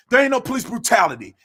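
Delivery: normal talking voice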